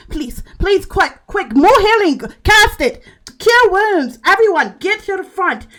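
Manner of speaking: high, loud, frantic pleading; panicked handmaid. Acted. Handmaid urging the healers to save the lady, high frantic pleas. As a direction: beg for healing in a panic. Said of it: "the handmaid's like".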